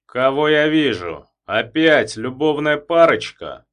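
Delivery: loud, slow bark. Acted. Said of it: "гаркнул он".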